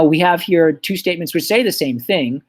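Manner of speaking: usual speaking voice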